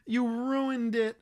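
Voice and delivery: imitating a whiny spoiler complainer; mock whiny complainer. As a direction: drag it out like a sulking fan. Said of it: "soft fucking underbelly of someone being like".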